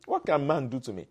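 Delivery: ordinary talk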